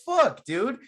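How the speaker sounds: genuinely annoyed at how dumb she is; exasperated rant. Real, not acted.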